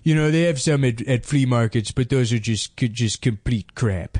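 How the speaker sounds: put-on hesitant nerd in a made-up accent; mock a video host with a weird invented accent; odd accent, fumbling through the presentation; That Sodding Gamer. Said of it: "this weird accent he made up".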